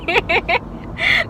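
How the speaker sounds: breathy high giggle through tears; tearful giggle while telling mom; high tearful laugh. Real, not acted.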